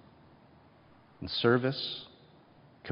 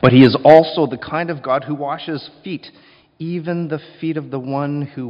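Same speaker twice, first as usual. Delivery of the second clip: loud, ringing proclamation; preaching shout; 3 semitones higher, 11 dB louder than usual. Real, not acted. Preacher booming to the congregation, loud. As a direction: call it out to the whole church.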